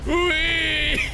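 high, long whee; gleeful whee. Pretend. Flung about in a goat game, high gleeful whee. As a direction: let out a high playful whee. Playful cry during game.

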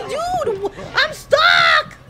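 high, loud yelps for help; frantic high-pitched cries; panicked shouting. Real, not acted. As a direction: yell that you are trapped. Stuck while attacked in game.

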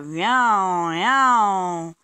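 loud, stretched engine drone, twice over; aeroplane noise. A narrator's reading. Baby Bear pretending to be an aeroplane, loud imitated drone. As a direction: swoop loudly like a child playing planes.

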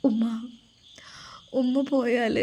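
whispered through tears; a small frightened whisper to mother; tearful whisper. Acted. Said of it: "ഇജ്ജ് ഇവിടെ പൊട്ടിക്കരയാ?".

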